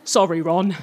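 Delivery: low, sheepish apology; mumbled little sorry, abashed. A narrator's reading. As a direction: own up to it sheepishly. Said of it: "he said sheepishly".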